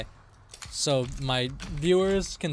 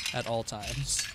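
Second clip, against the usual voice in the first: ordinary talk, nothing marked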